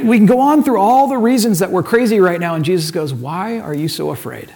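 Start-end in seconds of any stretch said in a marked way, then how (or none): 3.1-4.5 soft, lower, probing question; quiet probing question. Acted. Jesus repeats his question to the disciples, quietly probing. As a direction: ask it low and soft, probing. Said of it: "a probing question".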